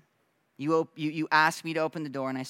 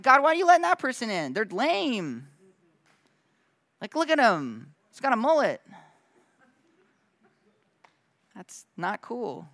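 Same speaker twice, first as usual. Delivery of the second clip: higher, louder, fast whiny gripe, slowing at the end; mocking complaint; 7 semitones higher, 5 dB louder than usual. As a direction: mimic a snobby passenger sneering at newcomers. Church people complaining, voiced mockingly.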